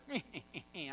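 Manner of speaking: mock villainous snicker; soft, hammed-up sinister giggle; evil ghost cackle. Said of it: "it was an evil ghost".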